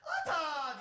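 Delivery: high, loud call with a laugh in it; excited call from across the room; calling out a name. Retold or imitated. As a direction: shout the name across the backstage. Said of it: "şöyle bir ses duydum".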